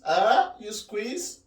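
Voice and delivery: amused question through a laugh; laughing disbelief. Real, not acted. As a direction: laugh at the idea.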